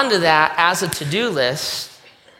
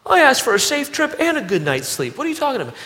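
much higher, indignant sulky voice; hammed-up offended believer talking back to God; mock indignant whine; 9 semitones higher, faster than usual. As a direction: act baffled and offended, like a petulant child. Whiny reply to God.

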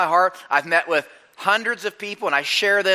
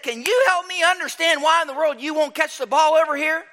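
frustrated coach asking; 9 semitones higher than usual. Retold or imitated. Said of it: "I said".

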